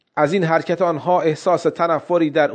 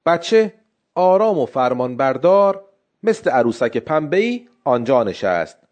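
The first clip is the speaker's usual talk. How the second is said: ordinary delivery, nothing marked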